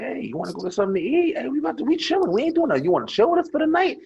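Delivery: high-pitched, casual come-hang-out pitch; acted pickup invitation. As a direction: replay chatting up girls, voice lifted high. Acting out old pickup approach to girls.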